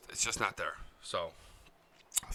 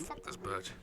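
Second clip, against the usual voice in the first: dropped low and much softer; muttered curse; 3 semitones lower, 15 dB quieter than usual. Retold or imitated. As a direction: curse her under your breath. Muttered insult to aunt.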